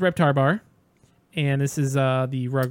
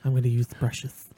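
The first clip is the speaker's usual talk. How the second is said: ASMR whisper; 4 semitones lower than usual. Pretend. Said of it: "This is the A S M R podcast".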